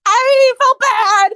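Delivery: loud, high, drawn-out plea; wailed protest. Acted. Hyden defending himself to Kira, high drawn-out wail. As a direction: wail it slowly in self-defence.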